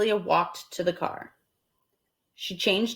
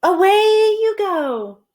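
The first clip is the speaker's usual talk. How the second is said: high, loud and slow send-off; Amelia Bedelia releasing fish; 12 semitones higher, 7 dB louder, slower than usual. Acted. Amelia Bedelia setting the fish free, high and slow. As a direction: let the fish go kindly. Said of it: "said Amelia Bedelia".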